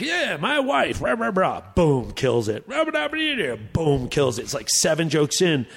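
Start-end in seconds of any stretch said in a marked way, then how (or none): 0.0-1.6 put-on high old-comic joke delivery melting into gibberish; mumbled impression of a veteran comic; Rodney. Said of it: "he's like". 2.7-3.6 high gibberish joke patter; wordless old-comic impression; Rodney. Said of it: "he's like".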